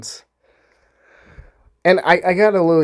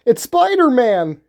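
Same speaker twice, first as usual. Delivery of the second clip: high, louder hammed-up cry of a woman; mock excited bystander; 10 semitones higher, 4 dB louder than usual. A woman in the crowd points at the hero, mock excited. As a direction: ham it up like a starstruck onlooker pointing.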